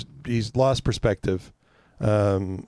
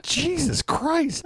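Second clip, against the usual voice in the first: exasperated groan; much slower than usual. Retold or imitated. Exasperated reaction to dad.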